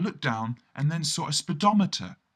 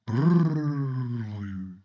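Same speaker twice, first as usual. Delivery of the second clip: lip-trill shiver; shivering brrr; 2 semitones lower than usual. Acted. Freezing on the crane top, a shivering brrr. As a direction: shiver it out through the lips.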